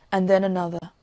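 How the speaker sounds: ordinary delivery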